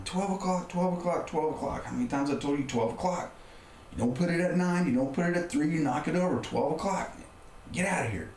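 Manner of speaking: high-pitched furious scolding, retold; retold angry yelling. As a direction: rant the rule at him, exasperated and losing it. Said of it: "I just felt bad for yelling at him".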